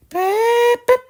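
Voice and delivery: very high, loud whistle beeps; train whistle beep. Acted. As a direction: toot like an engine whistle. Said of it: "whistled Edward".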